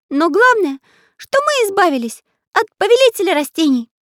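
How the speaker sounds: high, loud, merry cartoon voice; cheerful. A narrator's reading. Magic artist celebrates escape from villain, cheerfully. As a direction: celebrate it with voice pitched right up. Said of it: "весело сказал волшебный художник".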